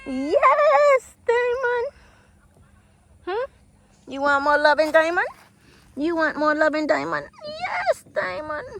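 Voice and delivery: high pet-greeting sing-song; pet talk. Real, not acted. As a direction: coo sweetly to a returning cat. Petting the cat Diamond.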